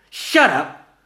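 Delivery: loud sharp high shut up; snapped. Acted. Gina silencing Vanilla, sharp snap. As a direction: bite it off. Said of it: "Gina snapped".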